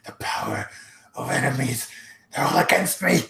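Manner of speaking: broken, halting mutter rising to alarm; paranoid muttering. Acted. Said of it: "hear him muttering".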